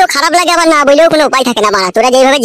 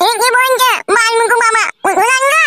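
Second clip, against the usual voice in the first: put-on high, pleading child's voice; whiny little boy; 7 semitones higher than usual. Small son begging father for big doll, whining. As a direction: beg like a spoilt kid.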